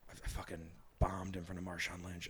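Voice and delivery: low, quiet retold dejection; deflated, hushed self-blame; beating himself up. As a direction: imitate yourself sulking after bombing, softly. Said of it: "beating myself up".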